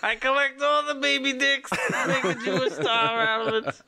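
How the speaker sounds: very high, laughing cartoon character; hammed-up high character voice; squeaky caricature voice.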